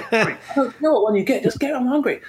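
high, dopey mock voice of a viewer at home; gormless audience member. Comic mocking a gormless Zoom viewer, high and dopey. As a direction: play the clueless home viewer mumbling about snacks. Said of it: "gormless kind of audience members".

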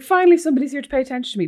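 overdone crying at last being noticed; mock tearful relief. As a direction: blurt it out like you've waited forever. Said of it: "I burst out crying".

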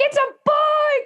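very high, slow squeal of joy; hammed-up gleeful new father; excited husband. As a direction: imitate an overjoyed new dad, way up high. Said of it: "my husband's like".

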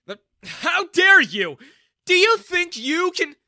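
furious high-pitched shout; explode, drawing out the words; outraged. A narrator's reading. Humiliated man turning on his young rival, outraged.

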